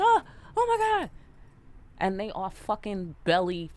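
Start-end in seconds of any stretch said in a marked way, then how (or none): 0.0-1.1 high, loud mock shock; mock startled. Reader playing the querent hit in the back of the head, mock startled. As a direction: exclaim as if smacked from behind, hammed up.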